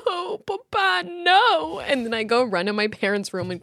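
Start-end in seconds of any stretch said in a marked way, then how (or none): 0.1-1.8 high, drawn-out mock cry; retold waking sob. Child waking from nightmare calling for Papa, mock sobbing. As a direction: wail like a little kid, hammed up. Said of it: "I wake up sobbing".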